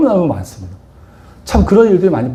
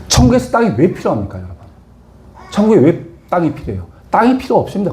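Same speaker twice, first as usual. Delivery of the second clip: rising preacher questions; 3 semitones higher than usual. Real, not acted. Rhetorical questions, raised pitch.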